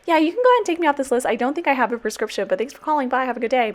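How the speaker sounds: high, fast, sugary-sweet brush-off; mock politeness to get rid of the caller; overly polite phone voice. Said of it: "I literally was just like".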